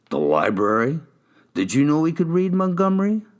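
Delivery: high, sneering mockery; mocking. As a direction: scoff at him, pitch rising. Detective mocking the suspect.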